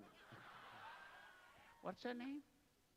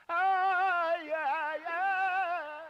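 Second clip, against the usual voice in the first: much higher, louder burst of yeah; excited cry; 8 semitones higher, 11 dB louder, much slower than usual. Real, not acted. Reacting to the child's answer with a loud excited yeah. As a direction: shout it out in delight.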